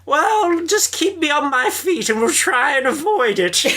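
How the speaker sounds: put-on high, haughty old-lady voice; Maggie as the Dowager. Dowager answering her dancing partner, grandly haughty. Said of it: "Classic Dowager".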